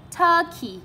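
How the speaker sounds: turkey with TUR high, key falling; stress on first syllable. Shown as a demonstration. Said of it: "cũng là trọng âm một luôn".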